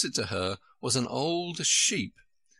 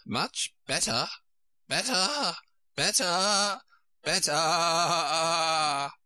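each repeat higher, last one a long bleat; rising squeak into bleat; 6 semitones higher, much slower than usual. A narrator's reading. Queen turning into a sheep, squeak becoming a bleat. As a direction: climb to a squeak, stretch the end like a sheep. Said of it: "her voice rising to a squeak as she went on".

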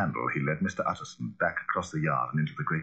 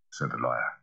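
plain reading voice

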